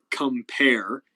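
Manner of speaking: "compare" with weight on "pare"; light "com", stressed "PARE" in "compare"; stress on second syllable. Shown as a demonstration. Showing students the next iamb, "compare" stressed on "pare". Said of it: "the pair ending is stressed".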